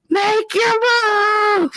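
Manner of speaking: very high, drawn-out mock holler; imitated heckling call. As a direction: holler it like strangers from a passing boat. Barge passengers call out to couple.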